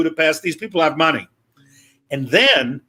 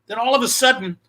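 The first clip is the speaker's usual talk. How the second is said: usual speaking voice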